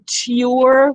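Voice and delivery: "ture" as a crisp T plus "yoor"; spelling pronunciation. Shown as a demonstration. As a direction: say "ture" with a true T, no ch sound. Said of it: "We don't say "ture".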